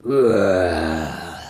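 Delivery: low, long bear groan; settling groan. Acted. Bears sit down for a rest, low groan. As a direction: groan down into a seat.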